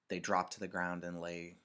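usual speaking voice

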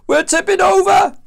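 very high, loud alarm; acted storm panic; panicked sailor's cry. A narrator's reading. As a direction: shriek a warning to the crew. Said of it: "cried another".

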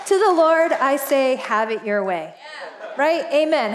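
ordinary delivery

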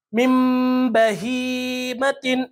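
very slow من بہیمتن, the n of من hummed as m; iqlab recitation. Shown as a demonstration. Teacher showing noon sakin before ba, iqlab on من. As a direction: hold a nasal m from من into بہیمتن. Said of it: "کس طرح سے پڑھیں گے".

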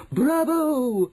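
high cheer; crying out. A narrator's reading. Witches applauding their leader, high cry. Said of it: "cried the witches".

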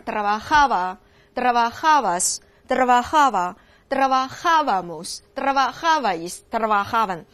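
slow "trabajaba" forms, each punched on the "ja"; stress on suffix's first A. Shown as a demonstration. Teacher proving the accent never moves, "ja" stressed in each "trabajaba" form. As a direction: hit "ja" in every form of "trabajaba", slowly. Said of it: "永远都是它所加的这个后缀的第一个啊上".

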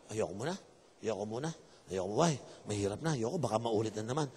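low, quiet mock dejection; acted sulky refusal, kept small; subdued refusal. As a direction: play someone burned and backing off, low and soft. Acts someone burned by a breakup.

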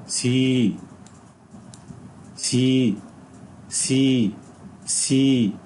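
سي drawn out with a long ee; model long vowel. Shown as a demonstration. Teacher drilling the letter sound for students, long vowel. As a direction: repeat سي slowly for the class. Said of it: "ده برضه لونج ساوند".

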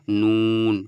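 নুন said loud, tongue tip against the palate; Arabic letter nun. Shown as a demonstration. Teacher modelling নুন for students, loud and clear. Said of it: "ভালো করে উচ্চারণ করুন".

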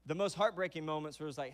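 ordinary delivery

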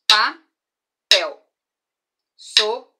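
"Papel" and "Sopa" split, loud; syllable-clapped. Shown as a demonstration. Teacher comparing two-syllable words, loud. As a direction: beat out "Papel" and "Sopa" in two parts each. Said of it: "dois pedacinhos".

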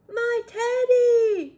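high, loud, drawn-out wail; slow storybook cry at full volume; cried out. A narrator's reading. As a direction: wail it in acted dismay. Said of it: "cried Eddie".

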